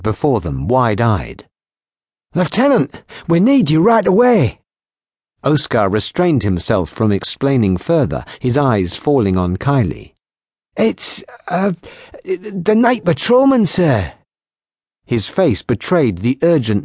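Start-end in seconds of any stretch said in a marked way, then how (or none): 2.3-4.6 higher, pressing call for the officer; urgent summons. Acted. Young Oscar bursting in to summon the lieutenant, urgent and higher. 10.8-14.1 put-on higher, halting young voice; hesitant young man. Oscar reluctantly reporting the patrolman, halting and higher.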